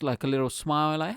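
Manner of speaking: ordinary talk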